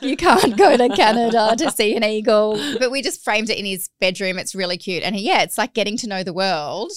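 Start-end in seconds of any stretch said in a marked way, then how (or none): 0.0-2.6 louder, mock exasperated telling-off; hammed-up frustrated mum. Mum answering son, exasperated.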